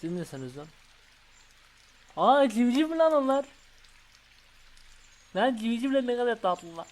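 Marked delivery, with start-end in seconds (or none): 2.2-6.9 delighted. Real, not acted. Sees cute chicks.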